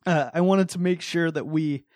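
holding back real emotion; getting emotional. Real, not acted. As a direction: soften as feeling catches up with you. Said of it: "I'm getting emotional for some reason".

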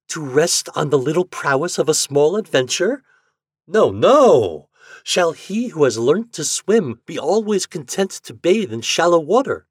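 eager wife. A narrator's reading. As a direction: push him on with spirit. Wife urging a bold climb.

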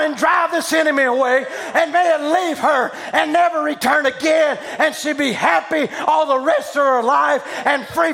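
loud revival preacher, pitch high and shouting; preaching at full force. Acted. Preacher shouting over a woman at the altar, full force. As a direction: preach it out to the whole hall.

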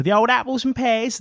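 lightly mocked Cockney accent, high. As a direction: toss off a jaunty Cockney line. Mocking cockney rhyming slang.

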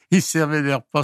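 ordinary talk, nothing marked

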